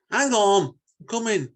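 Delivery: high, loud call to someone at the door; calling out from the garden. Acted. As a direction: shout it toward the window, eager. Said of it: "he called out".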